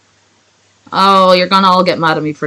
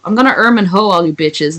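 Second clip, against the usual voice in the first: usual speaking voice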